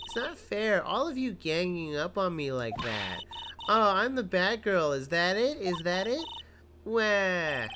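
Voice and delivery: high, drawn-out sulky complaint; hammed-up whining. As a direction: whine like a wronged girl, ending in mock wail. Cornered witness whines, fake wail.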